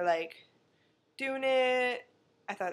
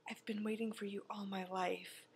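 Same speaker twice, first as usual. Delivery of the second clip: whispered, soft and dreamy, far softer; breathy whisper; 11 dB quieter than usual. Retold or imitated. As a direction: breathe it like a swooning lover. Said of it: "She says".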